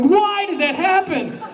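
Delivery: high, slow, laughing plea to the sky; mock anguish. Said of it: "I'm like".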